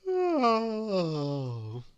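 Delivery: lazy yawned oh; yawning sigh. A narrator's reading. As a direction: yawn the word out. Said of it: "he yawned".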